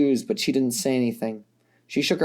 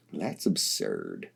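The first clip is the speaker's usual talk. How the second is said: much lower, softer, slow; dismissive Dirk; 10 dB quieter, much slower than usual. A narrator's reading. Dirk, raising an eyebrow at Vriska, low and dismissive. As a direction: scoff it off, deep and slow.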